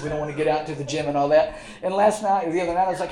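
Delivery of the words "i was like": ordinary delivery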